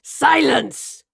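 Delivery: loud furious scream; enraged shout of silence. Acted. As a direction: scream it to cut her off. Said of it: "Raleigh screamed".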